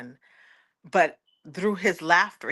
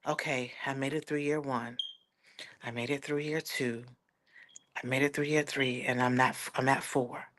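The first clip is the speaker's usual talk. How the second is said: softer, lower inner voice; inner counting; 5 semitones lower, 8 dB quieter than usual. Retold or imitated. Survivor counting years to herself, softer and lower. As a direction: quietly tick off the years. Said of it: "a little thing like".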